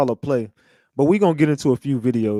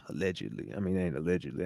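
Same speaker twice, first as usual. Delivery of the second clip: sly low aside; hushed sarcastic aside; 9 semitones lower, 12 dB quieter, faster than usual. Acted. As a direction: drop to a near-murmur, knowing. Sarcastic hushed aside after claim.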